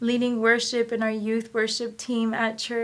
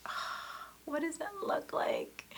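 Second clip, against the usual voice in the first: breathless whisper, higher; awed whisper; 7 semitones higher, 11 dB quieter, slower than usual. Retold or imitated. Woman asking God about her future, whispered. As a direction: hush it as if afraid to ask.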